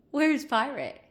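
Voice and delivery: playful gasped where's Pirate; excited question. Real, not acted. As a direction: tease the cat with his name. Gasps, teasing the cat.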